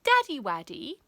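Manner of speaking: a hint of a soppy little boy; sugary son. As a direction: coo it at your dad like a baby. Son sweetly calls his father.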